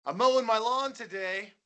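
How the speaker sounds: mock mundane rock star. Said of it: "they're just like".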